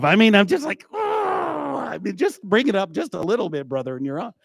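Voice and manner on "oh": high pained yelp at bad singing. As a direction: squeal like hearing nails on a chalkboard. Pained reaction to off-key singing.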